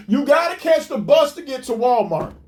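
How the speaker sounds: usual speaking voice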